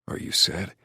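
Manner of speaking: hushed, tender question; concerned male lover. A narrator's reading. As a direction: ask softly as her worried lover. Lover asks about her tears.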